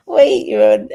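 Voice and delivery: usual speaking voice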